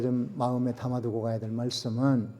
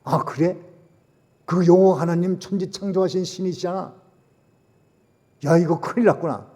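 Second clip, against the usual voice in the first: louder, higher dismay; the king grasping God's warning, loud and high; alarmed king; 5 semitones higher, 8 dB louder than usual. Acted. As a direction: realise the disaster aloud. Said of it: "여호와 하나님이 그러시답니다".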